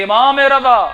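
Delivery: loud, high cry to the Imam; high mournful outcry; mother's wailing call. Retold or imitated. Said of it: "رو رو کے آوازیں دے رہی تھی".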